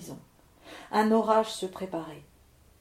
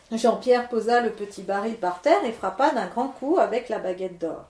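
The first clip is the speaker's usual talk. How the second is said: plain reading voice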